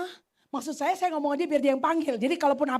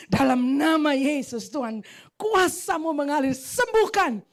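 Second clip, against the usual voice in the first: fervent prayer; 6 dB louder, slower than usual. Retold or imitated. Praying for the sick woman.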